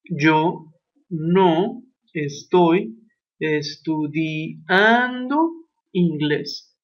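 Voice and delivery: very slow, word-by-word translation. Shown as a demonstration. Teacher translating the example for students, very slowly. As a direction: drag out each word for the class. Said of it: "Por ejemplo".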